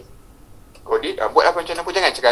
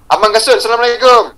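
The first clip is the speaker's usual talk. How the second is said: loud, higher-pitched hail; raised voice, projected to one listener; calling out greeting; 6 semitones higher, 6 dB louder than usual. Real, not acted. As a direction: hail someone across the room. Calling out to an audience member.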